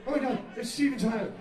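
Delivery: quick, high starstruck gush. Retold or imitated. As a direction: gush it fast, like a stunned fan. Retelling his starstruck reaction.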